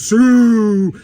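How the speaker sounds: put-on short loud shout of Sue, kids' celebration. Kids at football shouting Sue, loud yell. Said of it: "somebody goes".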